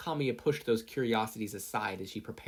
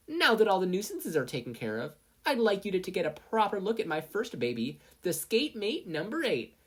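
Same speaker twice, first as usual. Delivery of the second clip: proud presenter; 9 semitones higher than usual. Acted. Mei presenting her skateboard to investors, proudly. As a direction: unveil your baby proudly.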